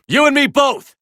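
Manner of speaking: high, loud answer over noise; battle yell; shouted reply. Acted. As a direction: shout it back mid-fight.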